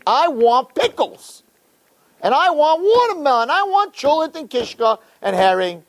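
high, mock-greedy demands; childish demanding. Acts demanding guest on Shabbos.

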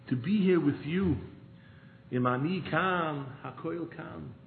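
ordinary talk, nothing marked

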